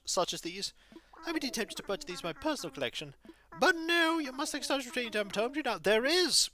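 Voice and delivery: high, hooting owl who startles awake, then greets politely; be a flustered old owl curator, hooting and courteous; fussy owl curator. Acted. Owl curator welcoming Sandstar to the museum, hooting and polite.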